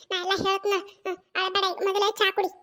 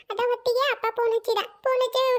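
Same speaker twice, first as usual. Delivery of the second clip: usual speaking voice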